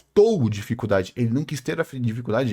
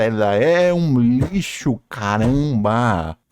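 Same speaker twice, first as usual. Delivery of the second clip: very slow, stretched-out verdict; exasperated drawl; 3 semitones lower, much slower than usual. Real, not acted. Ranting about a character whose design he likes, slow exasperated drawl. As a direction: drag out every word in exasperation.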